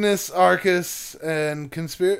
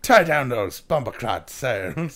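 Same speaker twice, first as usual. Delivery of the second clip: Jamaican pirate; 6 semitones lower than usual. Acted. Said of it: "say bumbaclot like a pirate".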